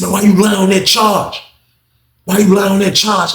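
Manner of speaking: high, insistent mock demand; acted angry accusation. Inmate accusing officer of lying on a charge, angry. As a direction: confront her, acting out the inmate.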